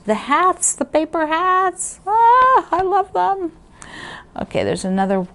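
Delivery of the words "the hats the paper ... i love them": very high-pitched, real adoring squeal; gushing delight. Real, not acted. Quilter showing viewers paper hat prints, gushing delight.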